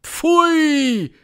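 high, loud, drawn-out boo; shouted disgust. A narrator's reading. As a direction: cry out like a revolted crowd. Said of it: "schrie das Publikum". (angewiderter Aufschrei, hoch und laut)

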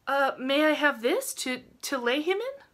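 high, halting request; tentative girl. A narrator's reading. Rilla asks for the tureen, tentative.

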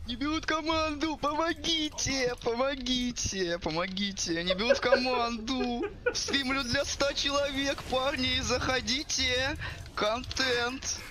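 high, slow pleading mock voice; mocking whiny streamer. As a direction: whine it like a desperate streamer.

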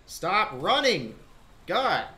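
loud, slow, high angry yelling; raging gamer. Real, not acted. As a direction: rage at the fleeing enemy. Chasing fleeing enemy.

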